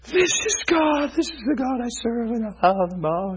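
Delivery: slow, high, hushed wonder; awestruck. Retold or imitated. Man to his wife in the ER, awestruck wonder. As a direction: breathe it in holy awe.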